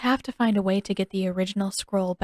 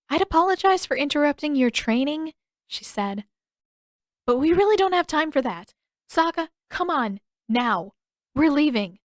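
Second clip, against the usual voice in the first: impatient urgency; 7 semitones higher, 4 dB louder than usual. A narrator's reading.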